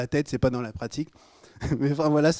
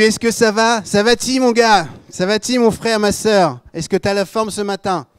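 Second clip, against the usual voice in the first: high, loud, hearty greeting; playful raised-voice prodding; rousing check-in; 7 semitones higher, 6 dB louder than usual. Acted. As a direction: shake your neighbour awake with it. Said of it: "secouez-le un peu. Dis-lui".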